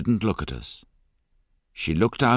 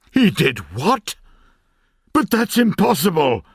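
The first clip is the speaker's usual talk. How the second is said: loud, much higher outburst of disbelief; shocked shout; 12 semitones higher, 8 dB louder than usual. A narrator's reading. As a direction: blurt it out, stunned and loud. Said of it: "shouted my uncle".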